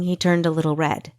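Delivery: ordinary delivery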